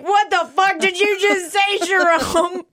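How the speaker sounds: high, drawn-out incredulous outburst; slow, very high astonished cry; retold disbelief. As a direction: squeal it in mock shock. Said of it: "And I was like".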